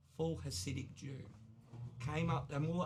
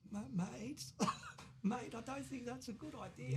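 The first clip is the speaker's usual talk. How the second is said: high, half-laughing plea of "mate"; nervous refusal; 8 semitones higher than usual. Retold or imitated. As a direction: back out nervously, pitch up, with a laugh. Nervously refusing skinhead more teardrops.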